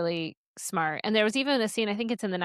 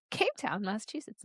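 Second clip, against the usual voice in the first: laughing; 6 semitones higher, 5 dB quieter than usual. Real, not acted. Laughs at own slip.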